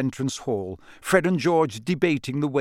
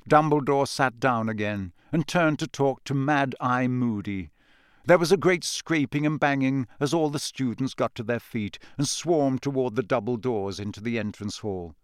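ordinary delivery

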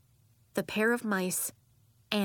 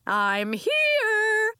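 high, loud sing-out from outside; big, high, carrying hello; vibrant call; 6 dB louder than usual. A narrator's reading. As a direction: call out vibrantly to announce your arrival. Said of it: "called a vibrant voice from outside".

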